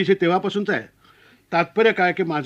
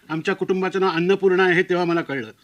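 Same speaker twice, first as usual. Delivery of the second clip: ordinary talk, nothing marked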